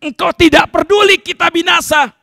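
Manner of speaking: high, loud accusing yell; angry shout. Acted. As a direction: demand help as if scolding. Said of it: "tapi pakai marah-marah". (teriak marah-marah)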